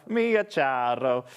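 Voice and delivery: low, drawn-out mock Italian accent. Shown as a demonstration. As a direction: stretch the name slowly, lightly mock-Italian. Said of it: "saying it in an Italian accent".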